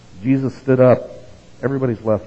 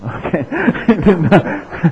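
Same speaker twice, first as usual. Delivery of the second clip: laughing; 8 semitones higher, 7 dB louder than usual. Real, not acted. Speaker laughs at name Keith.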